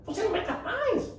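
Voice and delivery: squeaky high silly voice; mock it as a ridiculous high question; idiotic voice. Shown as a demonstration. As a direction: swoop way up high on the last word. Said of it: "É uma voz idiota mesmo".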